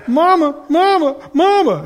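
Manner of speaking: imitating a sobbing toddler calling her mother; toddler wailing for mom. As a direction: wail for Mama like a toddler mid-meltdown. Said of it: "she's just blubbering".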